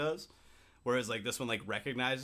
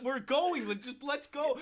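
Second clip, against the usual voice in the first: high, insistent retold urging; mock exasperation; 9 semitones higher, slower than usual. Acting out coaxing the drunk friend.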